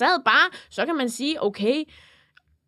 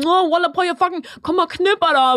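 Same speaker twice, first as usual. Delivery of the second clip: higher-pitched mock street tough; imitated shouting boy; 4 semitones higher than usual. Imitating a boy yelling at a cashier, provocative. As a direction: put on a provocative teen swagger. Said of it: "han står sådan og råber".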